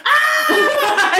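high, loud comic scream; mock scream. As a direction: shriek in imitation, half laughing. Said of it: "Ali's just going".

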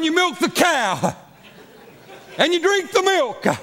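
chuckling through the words; can't stop laughing mid-joke; laughing while talking. Retold or imitated. Joking parody, laughing through it.

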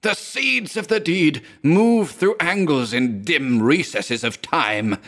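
high, slow, wild-eyed raving; hysterical raving. A narrator's reading. Pacing madman raves at his friend, high and slow. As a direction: chant it like a madman's prophecy.

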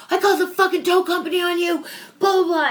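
high and loud, furious threat; retold angry yell. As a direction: shout it like an irate neighbor. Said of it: "yelling at me the whole time".

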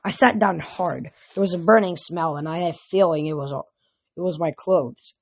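ordinary delivery, nothing marked

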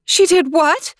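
high, loud shocked cry; alarmed. Acted. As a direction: call out in alarm. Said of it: "called out with alarm".

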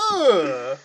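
loud, disgusted mock groan; recoiling groan. Viewer reacting to the bad accent, mock groan. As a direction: groan as if taken aback. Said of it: "I was like".

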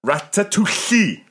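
Ratatouille slow and high, the ll hissed like Welsh ll; Welsh-style ratatouille. Acted. Tom giving in and saying ratatouille the Welsh way.

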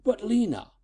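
high-pitched, pleading objection; protesting. Acted. Father tries to calm his angry daughter, protesting. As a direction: try to interrupt, pitched well up.

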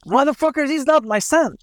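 angry outburst. Retold or imitated. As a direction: spit the curse out like the grieving father. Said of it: "he blasts".

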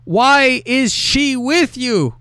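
high, very slow, drawn-out mock indignation; mock indignant demand. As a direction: stretch out each word like an affronted boyfriend. Reads jealous boyfriend's demand.

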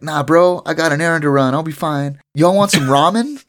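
high, breezy dude voice; chill bro voice. Retold or imitated. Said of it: "And Tendo's like".

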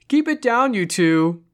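high, loud call across a campsite; playful holler. A narrator's reading. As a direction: holler it out in mock scolding. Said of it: "Rob hollered playfully".

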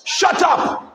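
mock telling-off, pitch climbing on the repeat; retold crowd rebuke. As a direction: act out bystanders silencing someone. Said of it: "People around said what?".

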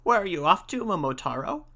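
lightly voiced monkey, starting high; a hint of an inquisitive monkey; monkey asking. A narrator's reading. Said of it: "says the monkey".

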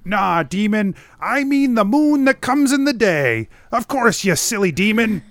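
high-pitched scolding hermit character; zrzędliwy Vrath. Acted.

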